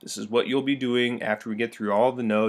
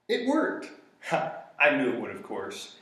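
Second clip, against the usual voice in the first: smug; 3 dB quieter than usual. Pretend. Smug aside, different voice.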